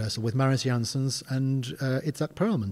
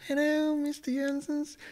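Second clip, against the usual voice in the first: much higher, small, meek hello; timid greeting. Retold or imitated. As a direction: squeak it like a nervous fan meeting his idol. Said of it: "I kinda went".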